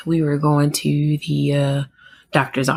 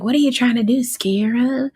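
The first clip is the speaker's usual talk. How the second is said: mock the wife's pitched-up scold; mimicking the wife; 8 semitones higher than usual. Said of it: "his wife was like".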